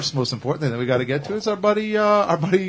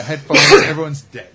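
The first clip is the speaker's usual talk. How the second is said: ordinary talk, nothing marked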